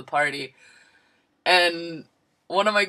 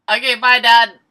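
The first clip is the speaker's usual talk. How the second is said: louder, higher hurried goodbye; panicked goodbye; 5 semitones higher, 11 dB louder than usual. Retold or imitated. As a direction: get off the phone fast. Hanging up on dad in panic.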